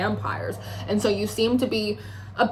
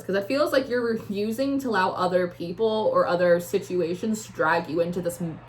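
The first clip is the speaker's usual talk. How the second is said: ordinary talk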